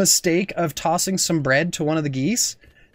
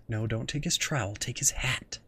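soft, fast urging under breath; urgent whisper; 7 semitones lower, 10 dB quieter than usual. Real, not acted. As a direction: hiss it at the screen. Urging own goose quietly.

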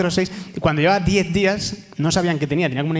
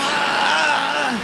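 mock shout; 10 semitones higher than usual.

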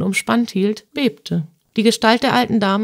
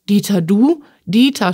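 mild cry of startled recognition; restrained shriek, rising into disbelief; shrill outcry; 4 dB louder than usual. Acted. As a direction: exclaim the name in shock, slightly raised. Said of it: "schrie sie gellend auf".

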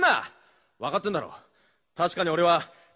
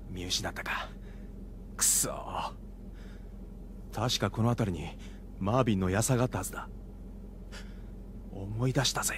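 lower, soft thinking aloud; muttered inner monologue; 11 semitones lower, 7 dB quieter than usual. Acted. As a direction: talk to yourself while sneaking in. Inner monologue while searching.

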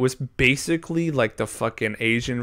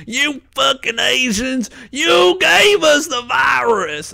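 high, loud, slow shouted accusation; mock yell; 13 semitones higher, 7 dB louder, slower than usual. Employee imagining a bigot shouting in a Chinese buffet. Retold racist customer yelling.

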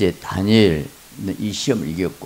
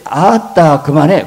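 mock annoyed wife; 6 semitones higher, 8 dB louder, slower than usual. Wife snapping at husband.

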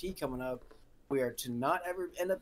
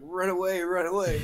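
loud retold group holler; imitated platoon yell; 3 semitones higher, 8 dB louder than usual. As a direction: shout it like the whole platoon. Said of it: "all the platoon yells".

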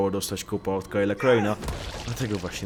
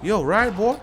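mock gangsta taunt; 10 semitones higher, 6 dB louder than usual.

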